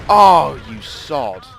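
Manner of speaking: loud bang then a startled yelp; shocked outburst. Real, not acted. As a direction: caught out by a lucky shot. Shot then hit back.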